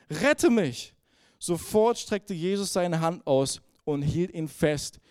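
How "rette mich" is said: higher, a touch louder cry; cry for help. A narrator's reading. Sinking Peter cries out to Jesus, mild narrated cry. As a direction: cry out to the Lord. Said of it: "schrie er".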